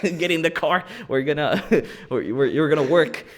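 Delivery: loud, laughing bossy order; laughing mock boss. Korean boss ordering the worker to work, loud and laughing. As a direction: bark it through laughter. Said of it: "like, speaking Korean".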